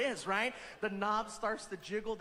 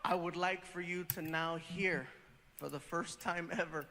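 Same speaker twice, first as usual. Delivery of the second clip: lower, softer emcee build-up; host intro; 7 semitones lower, 4 dB quieter than usual. Acted. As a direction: set up the big reveal.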